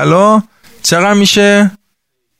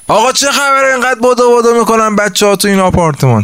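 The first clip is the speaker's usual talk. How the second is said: irritated complaint; 8 semitones higher, faster than usual. Retold or imitated. Neighbor complains about running kids.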